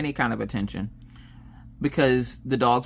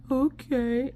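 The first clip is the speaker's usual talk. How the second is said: high, small rising voice; reluctant child; 13 semitones higher than usual. Retold or imitated. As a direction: imitate a kid meekly giving in.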